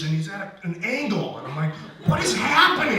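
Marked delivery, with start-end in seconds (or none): 2.1-3.0 high, loud, bewildered cry; frantic, high-pitched outburst; panicked yell. Retold or imitated. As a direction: yell it in alarmed disbelief. Retold panic at police station.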